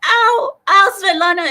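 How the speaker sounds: loud, high-pitched wail of pain; hammed-up tearful yelp; mock crying. Client under the masseuse's elbow, mock crying out in pain. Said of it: "I start crying".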